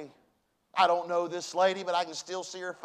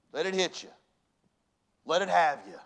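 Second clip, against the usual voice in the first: usual speaking voice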